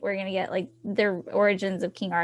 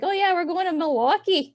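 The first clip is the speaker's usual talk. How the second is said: high, breezy quoted reply; retold matter-of-course brag, high voice; mimicking locals; 9 semitones higher, 4 dB louder than usual. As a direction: answer cheerfully as the local, pitched way up. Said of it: "They're like".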